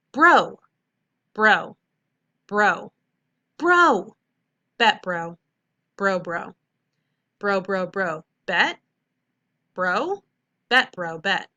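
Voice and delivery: loud, expressive "bro" and "bet" with rising pitch; hammed-up middle school slang; read with expression. Shown as a demonstration. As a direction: perform the slang with playful energy. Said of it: "read it with expression".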